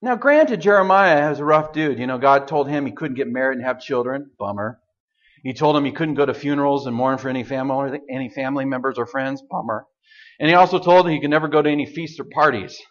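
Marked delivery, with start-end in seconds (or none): 4.4-4.8 much lower, softer, dry aside; deadpan bummer. Real, not acted. Deadpan comment on Jeremiah's fate. 9.5-9.9 much lower, softer, dry aside; deadpan bummer. Real, not acted. Deadpan comment on Jeremiah's fate.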